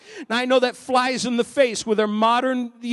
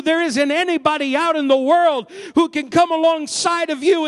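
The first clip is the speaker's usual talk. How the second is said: preaching at full force; 6 semitones higher than usual. Acted. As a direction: build the congregation up with passion.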